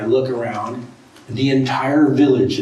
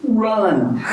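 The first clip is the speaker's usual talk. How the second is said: laughing warning; 9 semitones higher than usual. Retold or imitated. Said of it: "she turns to me and she says".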